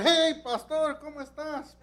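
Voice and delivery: put-on loud, high, sloppy drunk greeting; drunk husband. Drunk husband hails the pastor in front of police, loud and high. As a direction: play the cheerful drunk who spots his pastor.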